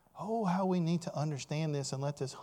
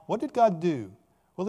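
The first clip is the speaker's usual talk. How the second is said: ordinary talk, nothing marked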